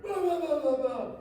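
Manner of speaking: high, loud ranting at the wife; mock yelling. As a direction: bellow it like a furious husband. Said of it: "I start yelling at the mom".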